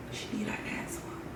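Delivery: a quick whispered jab under the breath; whispered aside. Real, not acted. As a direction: mutter it off to the side like a secret. Whispered aside about the girl.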